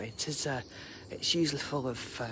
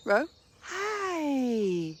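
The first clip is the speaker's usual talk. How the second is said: pet greeting; 10 semitones higher, 3 dB louder than usual. Real, not acted. Greeting her dog Romeo.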